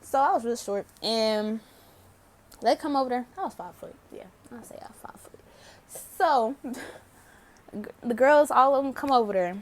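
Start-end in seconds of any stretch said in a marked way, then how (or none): 4.1-5.4 under the breath, quick; whispered aside. Real, not acted. Storyteller confessing her height to viewers, quick whispered aside. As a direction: slip it in as a secret.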